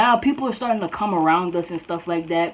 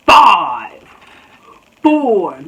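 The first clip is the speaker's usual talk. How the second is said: loud, spaced-out count; mock countdown yell; 10 dB louder than usual. Tiny girl counts down at student, loud mock threat. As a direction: imitate her bellowing each number.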